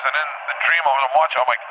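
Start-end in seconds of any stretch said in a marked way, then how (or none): none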